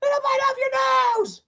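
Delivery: piercingly high shouted warning; alarmed warning shout. A narrator's reading. Husband scaring wife with the creature, shrieking. Mr Twit frightens his wife.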